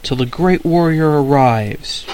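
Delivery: yelling. Acted. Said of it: "the old man was yelling".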